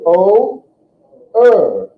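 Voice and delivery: "O" then "er", loud and clear; vowel demo. Shown as a demonstration. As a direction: drill "O, er" for the class. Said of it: "Say er".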